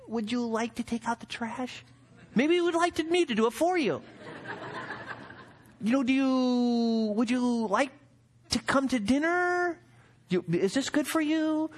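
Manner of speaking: high, timid, overly polite parent; mock-timid parent asking the son of God; nervously polite parent. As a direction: tiptoe around him like a nervous parent.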